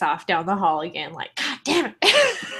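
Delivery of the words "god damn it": furious cat voice. Retold or imitated. Voicing furious cat leaving.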